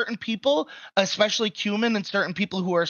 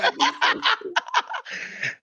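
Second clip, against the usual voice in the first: airy high laugh; breathy laugh; 13 semitones higher than usual. Real, not acted. Reacts to insult with laughter.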